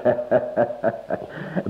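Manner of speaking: short airy chuckle under the breath; a quiet unvoiced laugh; soft breathy laugh. Beggar laughs softly, breathy. (ضحكة خافتة)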